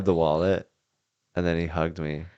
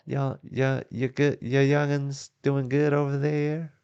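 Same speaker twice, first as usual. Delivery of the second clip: put-on high-pitched, slow folksy Southern accent; a hammed-up Southern granny asking after the kids, with a Southern accent; 9 semitones higher, slower than usual. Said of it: "while you do that accent".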